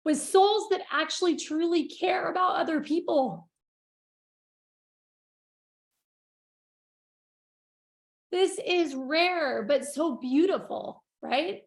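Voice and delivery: choked up, halting between phrases; getting emotional. Real, not acted. Guest moved by souls who truly care, choked up. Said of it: "start to get emotional right now".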